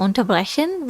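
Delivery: ordinary talk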